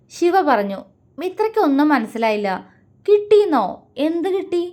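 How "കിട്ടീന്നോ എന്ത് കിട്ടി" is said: high, sharp astonished question; can't believe what she just heard. A narrator's reading. Mithra stunned by what Vishwa got, astonished. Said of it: "അവൾ അമ്പരപ്പോടെ ചോദിച്ചു".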